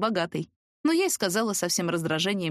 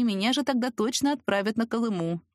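usual speaking voice

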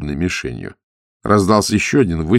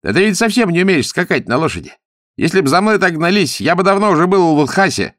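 high, loud, cocky jeer; taunting; 11 semitones higher, 5 dB louder than usual. A narrator's reading. Young vain husband of Darima provokes Zagorsky, taunting. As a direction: needle the enemy in a raised, high voice. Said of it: "стал его задирать".

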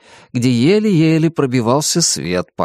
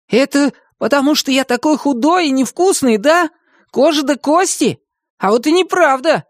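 high-pitched offended rant; huffy little hero; indignant; 13 semitones higher than usual. A narrator's reading. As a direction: protest in a high, excited character voice. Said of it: "возмутился он".

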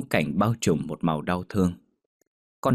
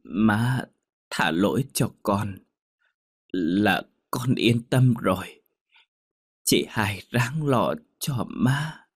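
slow, fading farewell; last words; 2 semitones higher, slower than usual. A narrator's reading. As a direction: say goodbye with the last breath, slowly. Dying daughter's last words. (lời trăng trối chậm rãi, yếu dần)

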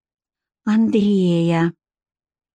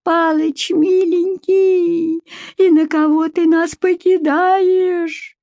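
high, long-drawn keening; mournful wailing; 9 semitones higher, slower than usual. Acted. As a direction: wail in grief over a dying husband. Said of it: "тоскливо завывала".

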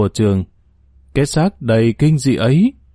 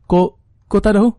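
high, quick, stammered question; startled fear; 7 semitones higher, faster than usual. Acted. Lý finding nobody behind the curtain, alarmed and bewildered. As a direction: catch on the first word in alarm. Lý finds the beds empty.

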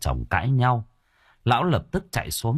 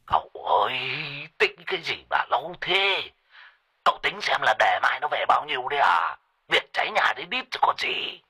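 put-on higher, impatient old man; 6 semitones higher than usual. A narrator's reading. Sorcerer nagging his master to hurry, impatient. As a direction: nag him to hurry up. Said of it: "Thầy Phọt sốt ruột".

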